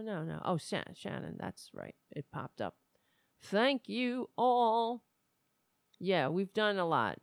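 usual speaking voice

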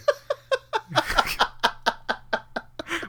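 high, breathless silent laughter; helpless wheezing laugh; hysterical laugh. Real, not acted. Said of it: "Why are you laughing so hard".

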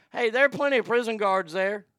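usual speaking voice

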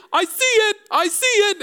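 put-on excited toddler. Father imitates son spotting the bus, excited squeal. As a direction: squeal it like an eager little boy. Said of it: "he'd say".

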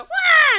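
high, very loud scream; group scream. Retold or imitated. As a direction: shriek in sudden fright. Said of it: "they all go".

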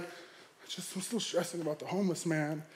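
very soft, half-whispered mock gloom; downcast. Driver telling girlfriend what's wrong, downcast. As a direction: mope it almost under your breath. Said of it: "I'm still sad, downcast".

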